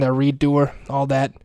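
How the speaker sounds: usual speaking voice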